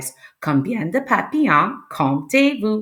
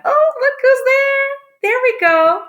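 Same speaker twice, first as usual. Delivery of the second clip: very high singsong discovery; playful surprise; 4 dB louder than usual. Pretend. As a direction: coo it high as you reveal a surprise. Playfully revealing a hidden animal.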